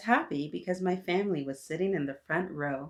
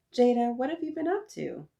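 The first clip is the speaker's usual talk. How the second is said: higher-pitched motherly question; voiced mother, pitched up; questioning Mama; 7 semitones higher, 4 dB louder than usual. A narrator's reading. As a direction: ask the child what happened. Mama questions child in story.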